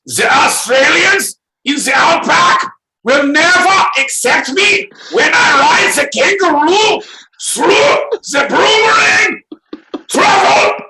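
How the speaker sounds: shrieking high fake German tirade; a screaming cartoon German, very high; mock German rant.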